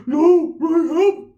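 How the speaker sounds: high, crying, panicky plea; scared whine. Retold or imitated. Stranded primo begging his cousin for a ride, scared and whiny. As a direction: beg like you're stranded and scared. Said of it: "that tone of voice".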